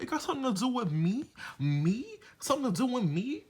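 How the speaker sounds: high, fast, incredulous mock Evelyn voice; mocking Evelyn upset. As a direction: squeak it out like she's offended and can't believe it. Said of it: "that New York comes out front and center".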